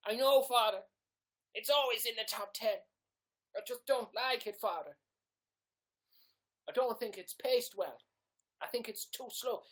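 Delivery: high, loud, pleading penitent voice; confessing gamer Jack. Acted. Jack admits to Father he dislikes a classic game, high-pitched confession. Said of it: "turn this into a character".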